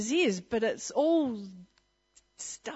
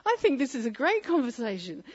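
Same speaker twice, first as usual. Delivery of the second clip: mock cheerful; 7 semitones higher than usual. Cheerful jab at the man.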